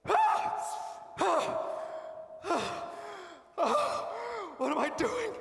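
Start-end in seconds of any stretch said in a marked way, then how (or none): 0.1-4.1 short sharp wails, the first very high; anguished cries. Acted. Joseph alone, crying out after seeing his youngest brother. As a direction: cry out in bursts of grief.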